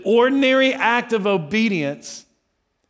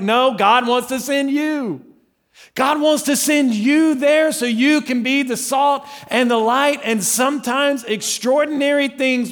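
preaching loud; 7 semitones higher, 4 dB louder than usual. Real, not acted. Preaching climax.

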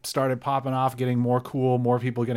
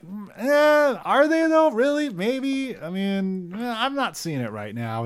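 skeptical doubting crowd; 9 semitones higher, 3 dB louder than usual. Retold or imitated. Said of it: "And people were like".

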